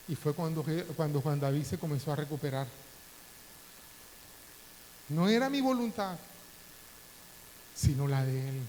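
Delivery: ordinary talk, nothing marked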